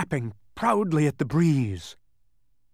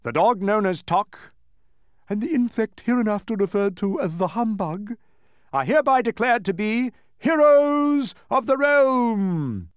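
formal proclamation; 8 semitones higher, 4 dB louder than usual. A narrator's reading. Cabinet proclaims Milo and friends heroes, loud declaiming. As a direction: declaim the honours to a crowd. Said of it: "continued the proclamation".

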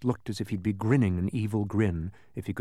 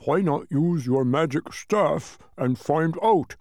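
louder, higher, slow muffled speech; mouth full; 5 semitones higher, 5 dB louder, slower than usual. A narrator's reading. Tock advising Milo with an eyedropper in his mouth, mouth full. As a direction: talk round an object held in your teeth. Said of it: "as clearly as anyone could with an eyedropper in his mouth".

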